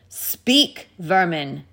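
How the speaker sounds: contemptuous, forceful witch queen; witch's angry command. A narrator's reading. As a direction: spit out an order as the witch. Witch threatens the silent animals.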